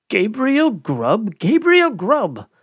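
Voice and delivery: high, slow, eerie chanted name; a wild chorus calling out high; wild chorus scream. Acted. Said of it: "screamed a wild chorus".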